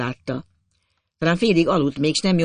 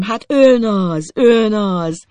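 louder, slow and tearful; almost weeping with relief; tearful joy; 6 semitones higher, 7 dB louder, much slower than usual. A narrator's reading. Embracing Lucien, loud and close to tears. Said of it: "akaratlanul is könnyezni kezdtem".

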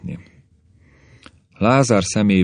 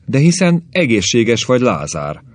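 bursting out; 5 semitones higher, 4 dB louder than usual. A narrator's reading. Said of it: "tört ki a szó Mártából".

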